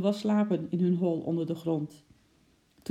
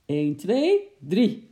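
louder count to three; countdown before the leap; 3 semitones higher, 7 dB louder, much slower than usual. Acted. Wim counts before jumping the suspect, louder countdown. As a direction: gear up to pounce.

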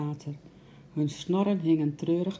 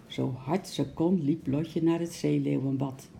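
usual speaking voice

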